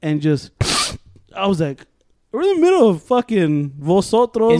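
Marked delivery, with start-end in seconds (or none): 2.3-4.6 high, loud disbelief, half laughing; incredulous scolding. Retold or imitated. Student to classmate chopping coke mid-class, incredulous.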